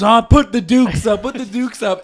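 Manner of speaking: high, loud hammed-up taunt; mock fight challenge. Shirtless man challenges property owner, mock fighting shout. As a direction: imitate a worked-up brawler calling someone out.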